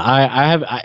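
ordinary delivery

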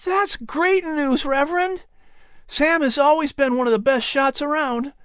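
put-on boy glad the threat is gone; relieved Patrick. A narrator's reading. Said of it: "Patrick's eyes widened with relief".

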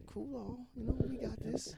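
high, soft coaxing voice; soft high imitation. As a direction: coax softly like inviting a hug. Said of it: "he's like".

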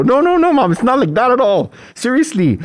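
high, loud mock-panicked denial; frantic protest. Son correcting mom's priesthood guess, frantically protesting.

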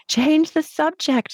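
high, slow, pleading mock scream; a girl begging her mother to stop, half-screamed; child screaming. A narrator's reading. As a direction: narrator voicing a child's desperate cry. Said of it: "she was screaming in the background".

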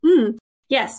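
ordinary delivery

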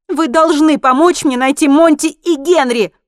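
high, loud, urgent insistence; desperate demand. A narrator's reading. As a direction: demand help at full voice. Woman demands his help.

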